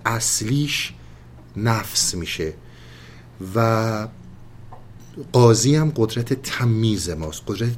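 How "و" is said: ordinary talk, nothing marked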